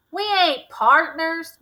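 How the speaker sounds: high, loud, slow denial; insistent denial. Acted. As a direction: drag out the denial, indignant. Suspect denies angrily.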